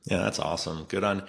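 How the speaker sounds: normal talking voice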